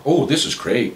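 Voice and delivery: ordinary delivery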